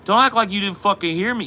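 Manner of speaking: high, loud mock-angry drunk; hammed-up drunk friend spoiling for a fight; imitating belligerent drunk. He's drunk.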